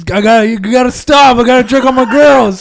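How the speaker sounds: loud, high mimicry of a friend; high-pitched, hammed-up urgency; mock urgent plea. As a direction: blurt it out in mock panic.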